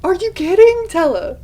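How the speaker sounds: high, loud fangirl squeal; excited squeal. Retold or imitated. Reader squealing at Tella to accept the offer. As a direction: shriek at the character to just take it. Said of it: "I screamed".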